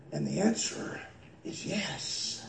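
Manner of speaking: low and very soft, with pauses; hushed answer. Real, not acted. Preacher answering whether Jesus is God, hushed. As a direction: drop right down for the reveal.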